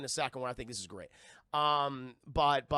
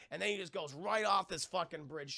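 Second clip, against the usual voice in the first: normal talking voice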